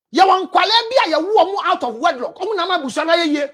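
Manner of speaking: ordinary talk, nothing marked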